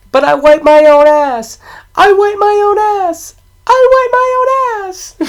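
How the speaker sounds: put-on very high, loud kid voice; piercing mock-kid shouting; yelling little kid. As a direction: shriek it like an insistent child. Quotes movie kid, repeated outburst.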